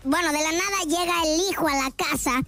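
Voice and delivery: ordinary talk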